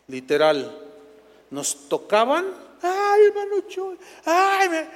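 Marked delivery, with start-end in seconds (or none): 2.8-4.9 high, loud swooning plea; high mock fan swoon; mock adoring fan. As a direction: mimic a fan clutching at the preacher. Imitating fans touching them.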